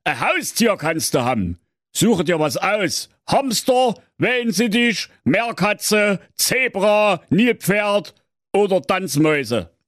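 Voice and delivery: put-on father lecturing his teenage daughter; stern father. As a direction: scold her like a know-it-all father. Father answers kindly.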